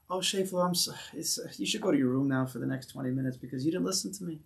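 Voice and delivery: soft, hesitant, halting reprimand; mock meek parent sending a child to the room; timid, hushed telling-off; too-mild discipline. Shown as a demonstration. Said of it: "a little bit more".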